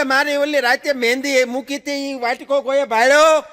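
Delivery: high, loud call from the kitchen; wife calling across the house; mock shout from the other room.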